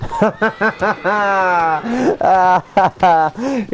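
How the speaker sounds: long, high burst of real laughter. Real, not acted. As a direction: let the laugh roll out high. Laughing at chat joke.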